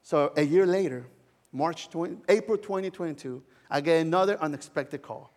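usual speaking voice

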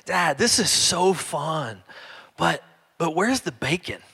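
slow, softly voiced small child; little girl voice. Small daughter asking dad about the bacon, slow and soft. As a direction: play the youngest, puzzled and sweet. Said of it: "Marley, she says".

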